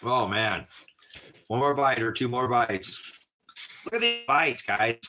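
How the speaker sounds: high delighted gushing; excited. Real, not acted. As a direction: rave about the bites. Savoring food, high excited.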